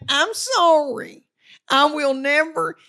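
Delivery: apologizing to her husband, mock meek and slow; mock meek apology. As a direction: play it hammed-up contrite.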